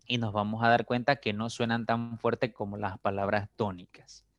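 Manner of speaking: usual speaking voice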